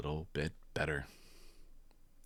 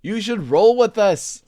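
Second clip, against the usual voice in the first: much higher, louder; playful monkey call; monkeys' voice; 14 dB louder than usual. Acted. Monkeys inviting Jim to swing, high and loud. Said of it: "said the zebras".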